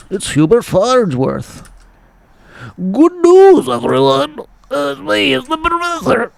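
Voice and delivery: high, wavering cartoon professor; Hubert Farnsworth. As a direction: pitch it way up like a doddery professor. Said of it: "That's a perfect impersonation".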